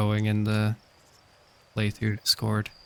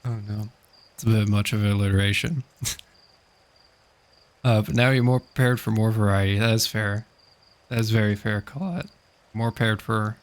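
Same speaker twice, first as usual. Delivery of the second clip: normal talking voice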